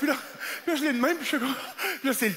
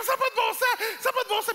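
high-pitched indignant outburst; angry rant; 10 semitones higher, faster than usual. Retold or imitated. Angry driver shouting at McDo through the window, high and indignant. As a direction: rant at the whole world in disbelief. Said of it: "je suis fâché".

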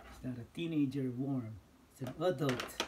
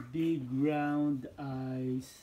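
slow; 6 dB louder, much slower than usual. Real, not acted. Drawn out for toddler.

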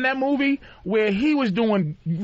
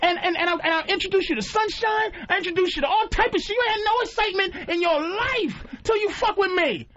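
higher indignant ranting; mock outraged rant; 6 semitones higher than usual. Kong scolding the woman who betrayed him, higher and indignant. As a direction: rant like a betrayed lover, voice climbing.

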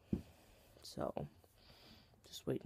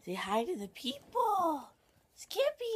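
coaxing pet talk; slower than usual. Real, not acted. Owner getting Skippy to greet viewers, high coaxing coo. Coaxing dog toward camera.

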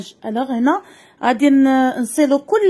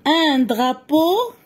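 "drapeau" said slowly with a clear D; slow clear dictation; slower than usual. Shown as a demonstration. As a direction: stretch "drapeau" so the D comes through. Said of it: "كنسمع دي". (نطق "drapeau" ببطء مع إبراز حرف دي)

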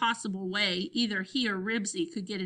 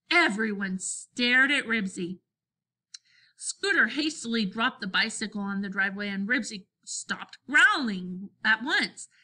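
plain reading voice

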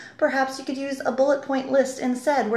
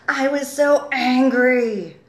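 ANGRY drawn out slowly and louder; stress on angry; 3 semitones higher, 5 dB louder, much slower than usual. Shown as a demonstration. Editor reads out a sentence with one italicised word, heavily stressed. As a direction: lean hard and long on the word "angry". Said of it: "this one word in a sentence that's stressed".

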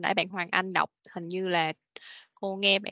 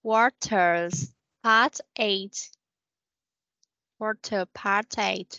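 slow model reading; 3 dB louder, much slower than usual. Shown as a demonstration. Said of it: "teacher models answer".

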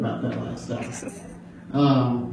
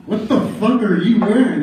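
loud, higher, laughing disbelief; incredulous mock outrage; 6 semitones higher, 5 dB louder than usual. Friend mocking my clothes, loud and laughing. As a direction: throw the insult out with a laugh.

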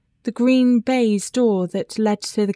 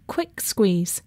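ordinary delivery, nothing marked

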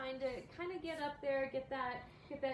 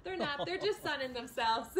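amused and raised; laughing; 6 semitones higher, 5 dB louder than usual. Real, not acted. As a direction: laugh while reassuring. Laughing about sunning meerkats.

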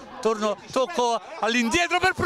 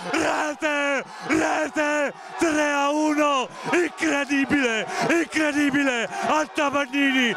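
breathless italian goal commentary. Real, not acted. Goal sealing the final.

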